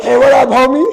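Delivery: high, booming homie greeting; loud phone greeting. Pretend. Answering a pretend call from his friend, loud hearty greeting. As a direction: holler hello to a buddy down the phone.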